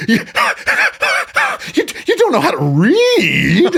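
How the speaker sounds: high, loud, squealing disbelief; mocking incredulous band leader. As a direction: shriek it like a scandalised teacher.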